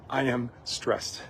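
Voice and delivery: a defeated murmur, slow and soft; weary, drained. Retold or imitated. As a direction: let it sag out, slow and soft. Quoting weary self-talk.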